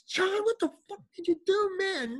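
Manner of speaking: high furious retold yell; ballistic. Finding the fish dead, yelling at his houseguest, high furious yell. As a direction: explode at him, voice flying up high. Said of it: "I went ballistics".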